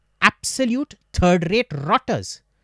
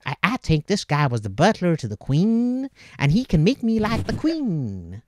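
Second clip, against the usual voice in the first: hammed-up awestruck American with swooping pitch; gullible American yuppie. New-money American marvelling at an English visitor, mock American yuppie voice. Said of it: "a yuppie little American who's never known money".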